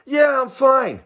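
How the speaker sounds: high, chipper yeah I'm fine; breezy lie. Retold or imitated. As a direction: cheerfully pretend all is well. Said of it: "What do I tell him?".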